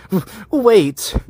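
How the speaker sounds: high stammered blurt; blurted plea. A narrator's reading. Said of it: "I blurted out".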